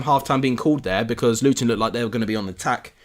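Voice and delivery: usual speaking voice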